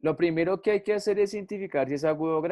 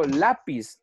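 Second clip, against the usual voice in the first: "lápiz" stressed on the first syllable, LA-piz; 4 dB louder than usual. Shown as a demonstration. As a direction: lean on "lá" in "lápiz". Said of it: "el acento no está en pis".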